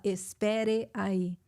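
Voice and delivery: "Espere aí" drawn out syllable by syllable; slow, over-careful. Shown as a demonstration. Teacher imitating lessons for an American, slow=. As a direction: stiff textbook "Espere aí". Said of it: "se ninguém fala".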